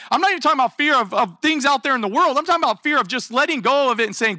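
ordinary talk, nothing marked

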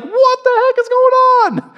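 high, loud squeal of panic; panicked fish. Acted. Preacher voicing panicked fish, high shocked squeal.

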